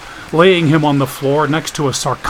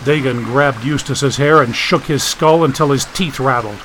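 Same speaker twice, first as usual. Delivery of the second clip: usual speaking voice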